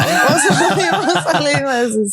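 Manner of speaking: laughing high question. Real, not acted. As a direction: giggle through the question. Laughing while asking.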